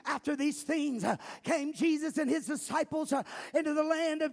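loud shouted call-out preaching; rally the crowd, then preach; preaching at full force. Acted. Call and response, scripture reading.

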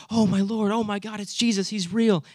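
high, hurried mock amazement; casual exclamation. Preacher mimicking Thomas stunned to see Jesus, mock exclamation. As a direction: blurt it out like a startled exclamation. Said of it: "not just making an exclamation".

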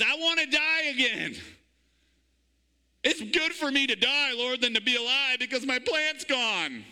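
high, whiny mock-pouting plea; hammed-up sulky complaint to God; pouty Jonah. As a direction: whine it like a sulking prophet. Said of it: "He starts pouting".

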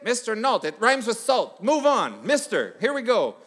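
weary sub raising his voice, brushing it off; exasperated sub. Retold or imitated. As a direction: push past it impatiently.

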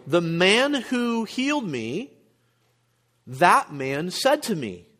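drawn-out emphasis on "healed" and "that man"; emphatic stress. Shown as a demonstration. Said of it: "So he's emphasizing".

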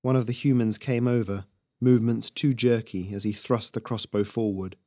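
usual speaking voice

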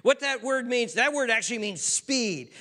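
usual speaking voice

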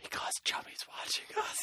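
secretive whisper. Acted. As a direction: whisper so he can't hear. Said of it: "Why are we whispering?".

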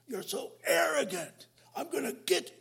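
a stern God, lightly hammed up; angry God. Preacher voicing an angry God rebuking pride. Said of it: "it's not God saying".

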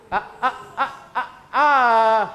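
breathy chuckling ha-ha bursts; laughing. Real, not acted. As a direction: laugh along with the crowd. Pastor laughs after fireworks remark.

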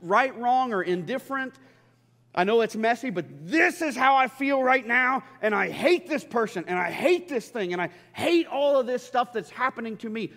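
loud, high outburst to God; venting frustration. Acted. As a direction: pour out pent-up frustration, building on each hate. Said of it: "when we vent our frustrations".

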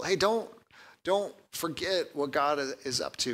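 a soft, lightly voiced apostle reminding his people; gracious reminder. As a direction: coax them gently not to forget. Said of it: "in a very gracious way".

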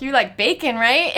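loud teasing, breaking into a high laugh; laughing taunt. Retold or imitated. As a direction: jab him about bacon, then crack up. Taunting the cop as a pig.

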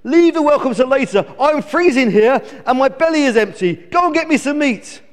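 high, loud bratty chick; high-pitched bossy complaining; demanding bellow. A narrator's reading. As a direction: order Dad about impatiently. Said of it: "bellowed little, little chicklet".

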